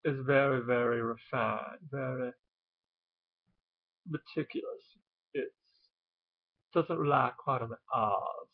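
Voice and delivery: refined, genteel Southern accent; Southern gentility, soft on the R's; upper crust Southern accent. Shown as a demonstration. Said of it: "upper crust Southern accent".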